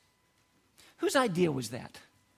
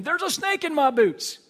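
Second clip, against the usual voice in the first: much higher and louder, bouncy and teasing; playful baby talk; 8 semitones higher, 4 dB louder than usual. Acted. Father repeating the joke to baby Nate, bright high tease. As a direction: deliver it like a punchline to a giggling baby.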